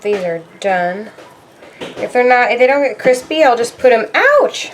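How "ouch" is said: sudden yelp of pain; ouch. Real, not acted. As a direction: yelp as if burned. Burned touching hot crisps.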